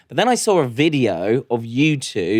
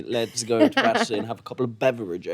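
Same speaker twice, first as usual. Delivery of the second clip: mock posh English; 3 semitones lower, 4 dB quieter than usual. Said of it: "That's how Australians think that you guys are".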